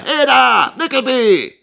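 high, loud call; shouted summons. A narrator's reading. Schoolmaster calling his assistant from the stairs, shouting. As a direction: holler up to him by name.

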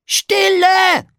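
very high, loud scream. Acted. Piggledy screams for silence in the forest, very high and loud. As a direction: scream it into the woods. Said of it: "schrie Piggledy".

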